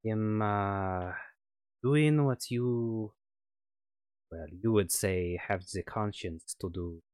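put-on light on-and-off French accent as the villain; a French scientist, accent slipping in and out. GM as French ex-colleague on the phone, light French accent. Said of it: "excuse my terrible French accent".

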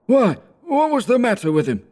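put-on very high, thin old merchant; high squeaky merchant. A narrator's reading. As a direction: squeak it like a snuffy old man. A third merchant, snuff taker.

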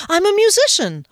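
high, loud triumphant cry. Acted. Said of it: "I cried with triumph".

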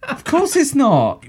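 high mock outrage, drawn out; exasperated quizmaster. Quizmaster berating a contestant who buzzed in, mock fury. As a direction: scold them in a high, incredulous outburst. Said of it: "he'll go, "No!".

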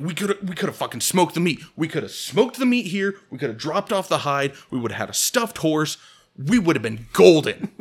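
loud, exasperated acted rant; worked-up comic complaint, building to a loud finish; yelling at him. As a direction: yell each missed chance at your friend. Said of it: "I'm just fucking yelling at him".

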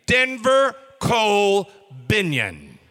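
loud, slow, each name spaced; calling child's full name. Retold or imitated.

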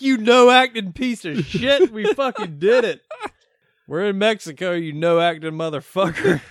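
hammed-up victory shout; mock elated yell. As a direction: crow with triumph. Quoting elated Seth.

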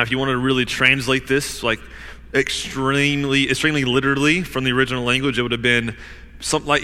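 usual speaking voice

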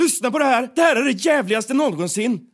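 exaggerated indignation, voice pitched right up; Joakim Lamotte impression. Podcaster practising the Lamotte parody for a co-host, outraged impression. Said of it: "Din Joakim Lamotte är ganska bra".

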